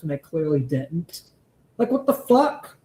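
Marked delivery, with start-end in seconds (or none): 1.8-2.8 incredulous outburst. Real, not acted. Streamer ranting in disbelief.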